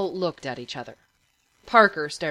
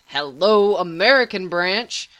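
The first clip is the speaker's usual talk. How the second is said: loud, exasperated retort; irritated; 7 dB louder than usual. A narrator's reading. Patient answering a teammate's objection, irritated. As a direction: stress it loudly, as if stating the obvious. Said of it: "looking irritated".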